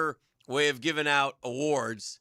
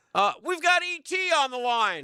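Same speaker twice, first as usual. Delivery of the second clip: high, loud radio host voice; Hacker impression; 13 semitones higher, 4 dB louder than usual. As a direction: announce the caller like a radio host. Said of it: "That's my Hacker impression".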